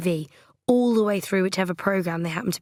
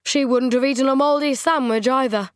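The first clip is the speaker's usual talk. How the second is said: higher, louder sulky accusation; accusing; 5 semitones higher, 5 dB louder than usual. A narrator's reading. Trent comparing Coral to his late wife, accusing. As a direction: rub her nose in it. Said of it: "His tone was accusing".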